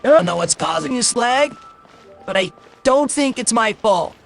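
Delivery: normal talking voice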